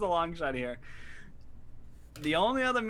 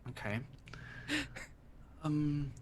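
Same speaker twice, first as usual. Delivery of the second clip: normal talking voice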